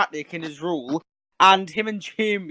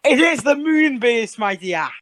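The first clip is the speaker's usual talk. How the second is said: slow, raised-pitch dramatic announcement; grand mock reveal; 5 semitones higher, 3 dB louder, slower than usual. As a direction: draw out the big reveal theatrically. Mock drumroll reveal of favourite story.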